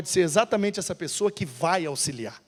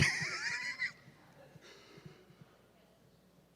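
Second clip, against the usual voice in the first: risada curta; 19 dB quieter than usual. Real, not acted. Risada após a história.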